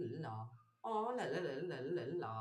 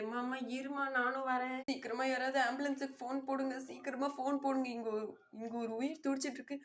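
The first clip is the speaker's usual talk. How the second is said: urgent calls; 5 semitones higher than usual. Acted. Daughter begging the watching crowd to call an ambulance.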